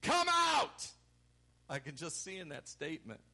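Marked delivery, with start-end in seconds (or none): 0.0-0.9 very high, very loud drawn-out call; huge commanding holler; full shout. Acted. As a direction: shout to raise the dead. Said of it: "And Jesus shouted".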